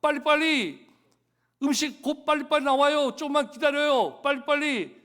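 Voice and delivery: high, loud mock hurry-up calls; teasing imitation. Foreign restaurant staff teasing Korean diners, high and loud. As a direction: mimic restaurant staff poking fun at impatient customers. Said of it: "자기들이 우리 놀려준다 그러는 거예요".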